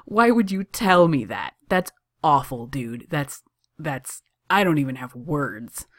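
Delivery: delighted disbelief that fades as it goes. A narrator's reading. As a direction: open with an outburst, then run out of words. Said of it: "leaning over the counter delightedly".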